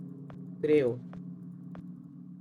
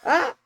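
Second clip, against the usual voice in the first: sharp high yelp of fright; jolted into a high scream; startled scream; 13 semitones higher, 8 dB louder than usual. Real, not acted. Sudden scare while playing.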